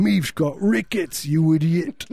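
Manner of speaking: high, slow, caricatured English whine; mock English accent. Fake Englishman insulting a stranger, hammed-up English accent. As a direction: squawk it in terrible English accent. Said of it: "Just do the accent".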